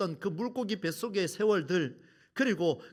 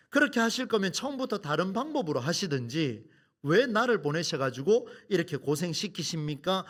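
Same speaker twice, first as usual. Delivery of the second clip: normal talking voice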